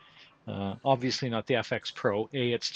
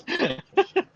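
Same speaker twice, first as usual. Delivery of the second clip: high squealing laugh; 7 dB louder than usual. Real, not acted. Still laughing at the joke.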